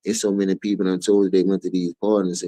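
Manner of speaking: ordinary talk, nothing marked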